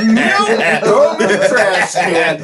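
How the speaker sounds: high, loud, laughing no; laughing protest. Retold or imitated. Telling the guy who threw his underwear at him to bin it. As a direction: yelp it out while laughing, slowly. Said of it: "And I was like".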